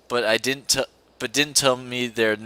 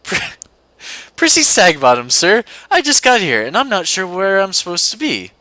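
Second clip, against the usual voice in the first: higher, louder nervous schoolgirl apology; flustered student; 7 semitones higher, 6 dB louder than usual. Acted. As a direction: blurt it out, caught off guard. Flustered student answers the professor.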